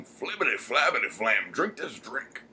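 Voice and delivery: swooping nonsense-talk comedian impression; Bill Cosby. Co-host riffs on the name joke, celebrity impression. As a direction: babble it as a playful celebrity parody, pitch bobbing. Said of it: "hear it in the Bill Cosby voice".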